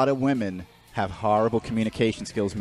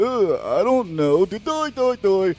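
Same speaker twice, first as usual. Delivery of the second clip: high, dim-witted doofus voice; mocking the guitar store clerk who knows nothing, dopey voice; 6 semitones higher than usual. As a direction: play the clueless store clerk, slack and goofy. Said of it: "He's like".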